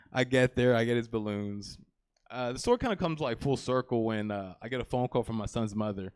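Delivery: usual speaking voice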